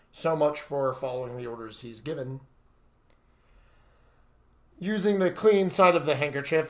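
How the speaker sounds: ordinary delivery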